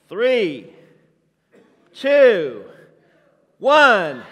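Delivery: loud counted-down shout; big shouted countdown. Acted. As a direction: bellow the countdown to the crowd.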